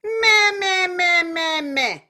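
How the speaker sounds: high, loud nasal meh falling. Shown as a demonstration. Teacher demonstrating nasal meh for the class. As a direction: push meh through the nose.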